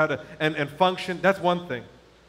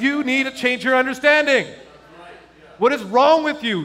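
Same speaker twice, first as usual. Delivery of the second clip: raised, high-pitched scolding as God; stern rebuke; 7 semitones higher, 6 dB louder than usual. Retold or imitated. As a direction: rebuke him firmly. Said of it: "He literally rebuked me".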